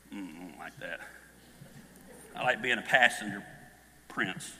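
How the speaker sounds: low put-on voice turning down the offer; lazily refusing in a low put-on voice; mock wife reply. Voicing the wife's imagined reply.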